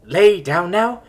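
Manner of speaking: loud, high laid-back surfer bro drawl; a stoner android, totally relaxed but brash; bold chill bro. A narrator's reading. Android diagnosing Simone, loud high chill bro voice.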